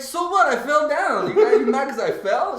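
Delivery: high, incredulous mock argument; retold defensive outburst. Hungover man arguing back at his girlfriend, defensive. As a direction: protest it high, as if wrongly blamed.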